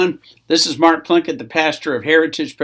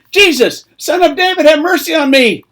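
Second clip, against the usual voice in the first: high, loud cry for mercy; shouted plea; 11 semitones higher, 7 dB louder than usual. Acted. Blind beggar calling to Jesus as he passes, shouted plea. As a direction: yell your head off to be noticed. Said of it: "He began to shout and say".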